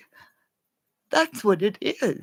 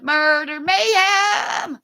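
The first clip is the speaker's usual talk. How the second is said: normal talking voice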